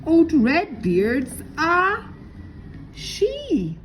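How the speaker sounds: very high, loud, slow astonishment; shocked. A narrator's reading. Pegleg learns Redbeard is a woman, high and amazed. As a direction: squeal it out in disbelief.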